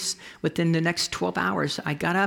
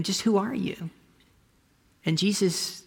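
ordinary delivery

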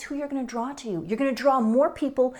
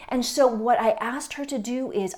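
ordinary talk

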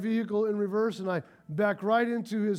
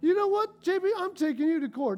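high, fast, indignant rant at a friend; angry outburst; 6 semitones higher, faster than usual. Acted. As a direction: play the exasperated truck owner, rattling off threats. Acted angry reaction to dented truck.

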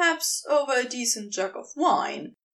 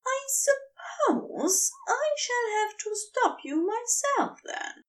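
much higher, tight and near tears yet resolved; resolute angel; 12 semitones higher than usual. A narrator's reading. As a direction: brace yourself and declare it. Aziraphale voiced by narrator.

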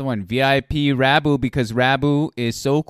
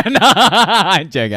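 laughing; 9 semitones higher, 10 dB louder, slower than usual. Real, not acted. Laughs off his own tease.

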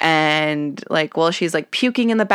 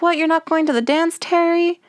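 put-on high quick girly voice, much higher; Ashley impression; 10 semitones higher than usual. Imitating Ashley asking Terry about the dance, high and quick. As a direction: rattle it off like a bossy friend. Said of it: "and Ashley's like".

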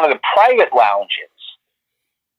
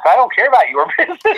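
high, laughing brush-off; laughing scoff; 9 semitones higher, faster than usual. Retold or imitated. Mocking the publicist's worry, with a high laugh. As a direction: toss it off with a laugh. Retold defiant inner thought.

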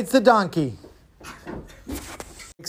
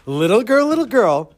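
teasing call, higher and louder; mocking; 4 semitones higher, 4 dB louder than usual. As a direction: taunt a little girl playfully. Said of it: "wants to have a good laugh making fun of Bracha".

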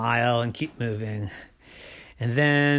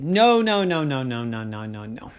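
protesting refusal. Acted. Kenny answers the hungry shark, protest fading away. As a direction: let the protest slide down and die away.

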